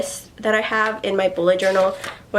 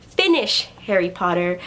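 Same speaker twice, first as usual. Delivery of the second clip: usual speaking voice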